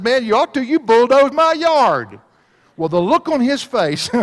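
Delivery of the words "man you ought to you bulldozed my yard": high, loud retold challenge, stretched at the end; loud confrontation. Preacher retelling how he confronted the wrong man, loud confrontation. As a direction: confront the stranger at full voice, hammering it home.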